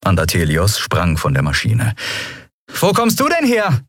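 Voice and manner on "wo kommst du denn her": hoch und überrascht gerufen; rufend. A narrator's reading. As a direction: ruf ihm erfreut entgegen. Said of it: "rief Endriel". (Endriel ruft dem Admiral erstaunt zu)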